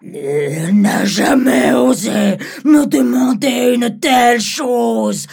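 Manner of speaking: loud, slow indignant dragon; outraged dragon. Acted. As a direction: drag out the outrage. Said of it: "dit-il".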